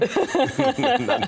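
squealing high laugh; high burst of laughter. Real, not acted. Laughing at night/naked pun.

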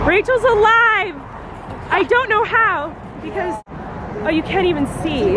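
relieved cry. Real, not acted. Learning her sister survived the crash: relieved shout. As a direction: blurt it out in shaky disbelief.

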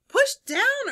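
high, loud push of encouragement; urging. A narrator's reading. Elephant urges Mouse on the seesaw, urging. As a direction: urge it out, pitched well up. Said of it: "urged Elephant".

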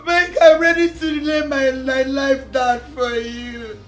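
tearful wail swelling into loud cries; crying plea. Acted. Betrayed woman asking Mike why, crying plea. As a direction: cry out in betrayed anguish.

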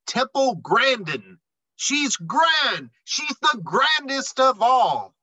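cheering praise. Acted. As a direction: sing her praises like a crowd. Crowd praises Temple.

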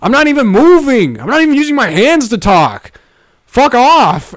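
high, loud indignant outburst. Retold or imitated. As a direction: protest loudly that you did nothing. Retold defending himself to woman.

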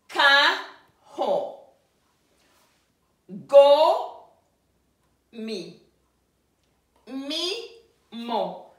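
slow syllable drill, stressing KA in "Ka ho", GO in "go mi", MI in "mi mo"; first-syllable stress. Shown as a demonstration. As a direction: hit the first of each pair in "Ka ho, go mi, mi mo", let the second drop. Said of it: "keep the stress on the first syllable".

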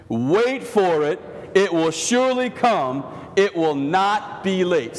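slow, measured call for the room to repeat; slow congregational refrain. Shown as a demonstration. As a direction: lead the church in saying it slowly. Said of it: "Say that with me".

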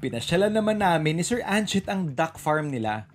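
ordinary delivery

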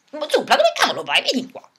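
high squeaky puppet voice calling the owner; a little diva summoning her owner; bossy giraffe puppet voice. Acted. Puppet giraffe calling for Zupla, high and bossy.

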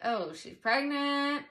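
slow, pointed "pregnant" with a judging edge; judging remark. Retold or imitated. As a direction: say it like a disapproving stranger. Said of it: "Or if it's like".

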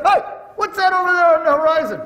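high, loud hail across the water; calling out. Acted. Man on a raft hails the other rafts, loud. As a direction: shout over to the next raft.

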